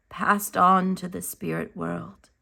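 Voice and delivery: ordinary delivery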